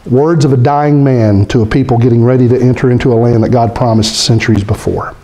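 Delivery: ordinary talk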